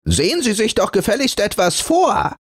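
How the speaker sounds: high, motherly scolding; a protective linen telling him off; annoyed high voice. Acted. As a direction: linen scolding the fur for the lace. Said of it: "ärgerlich".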